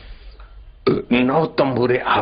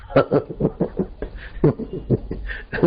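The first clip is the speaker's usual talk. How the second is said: acted burst of pent-up giggles; bubbling-up laugh; 3 semitones higher, 4 dB quieter than usual. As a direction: let suppressed laughter leak out in breathy bursts. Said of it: "दबी हुई हंसी कठियारा के अंदर से उछली". (दबी हुई हँसी का फूटना)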